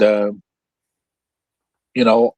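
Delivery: ordinary talk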